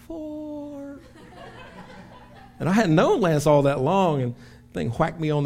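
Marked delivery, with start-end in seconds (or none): 0.1-1.0 tiny, high, soft far-off "Four"; faint distant call. Retold or imitated. Pastor imitates how the shout reached him, faint and high. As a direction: make it fade out like it's far away. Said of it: "it sounded like he was".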